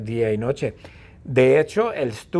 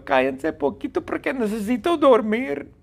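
begging to sleep; 10 semitones higher than usual. Retold or imitated. Stewie begging the warts to let him sleep, high pleading voice. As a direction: beg them to hush so you can sleep. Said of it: "Y Stewie les grita".

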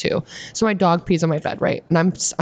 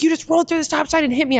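mock screaming; 11 semitones higher than usual. Retelling the angry couple after the crash, high mock yell. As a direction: imitate an angry driver yelling, pitched way up. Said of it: "They're screaming at the top of their lungs".

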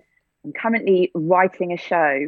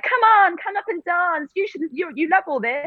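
high, excited pushy relative voice; coaxing her onto the dance floor; imitating pushy relatives; 11 semitones higher than usual. Wedding guests urging her to dance.

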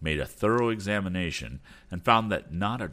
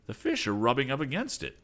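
higher, eager cry; excited cry; 7 semitones higher than usual. Acted. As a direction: call it out with wonder. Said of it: "cried Tom".

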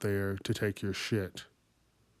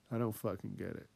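much softer "I don't fucking get it" as an aside to himself; quiet repeat; 3 semitones lower, 10 dB quieter, faster than usual. Real, not acted. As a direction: mumble it again under your breath. Repeating line, then dropping quiet.

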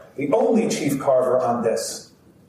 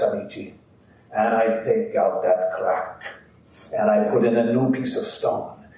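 put-on grandfather telling his story in heavy Italian accent. Grandfather to the audience, heavy Italian accent. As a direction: play the old Italian carver proudly recounting. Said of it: "puts on this heavy kind of Italian accent".

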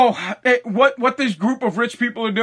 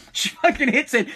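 ordinary talk, nothing marked

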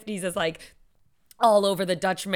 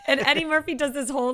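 ordinary talk, nothing marked

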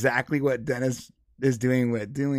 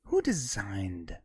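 imitating Dennis; 4 semitones lower, 6 dB quieter than usual. Dennis lecturing operator.

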